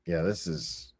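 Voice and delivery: soft, slow whisper with a laugh; whispered aside. Real, not acted. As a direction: breathe it out under your breath, amused. Aside remark about the husband.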